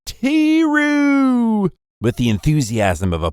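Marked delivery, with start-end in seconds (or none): 0.1-1.7 high, drawn-out call; sprint-up shout, pitched way up and stretched; excited yell. A narrator's reading. Girl greeting him at the school gate, excited high yell. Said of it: "at the school gate yelling".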